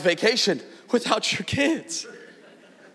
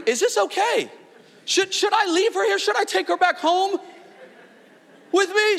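high, fretful second-guessing; a worried dad asking himself whether to take her home; anxious worry; 12 semitones higher than usual. Retold or imitated. Worried dad at drop-off, high and fretful.

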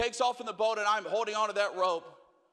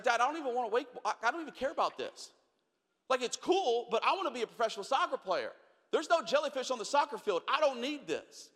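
put-on higher, pleading kid to his dad; a boy begging to skip wakeboarding; higher kid voice whining to dad; whiny kid; 6 semitones higher than usual. Young [name] whining to dad.

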